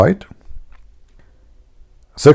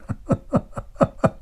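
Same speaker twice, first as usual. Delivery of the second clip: quiet breathy chuckle; 11 dB quieter than usual. Real, not acted. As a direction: laugh softly to yourself. Laughing at own joke.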